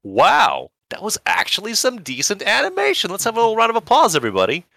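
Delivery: high squeaky cartoon cat cheer; cat calling out. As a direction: announce it like a smug cartoon cat. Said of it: "the cat calls out in scene".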